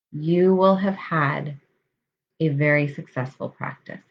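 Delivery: calm, measured meditation voice with long pauses; guided meditation. Real, not acted. Coach leading listeners through breath awareness, guided meditation. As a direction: settle them into the breath, unhurried and even.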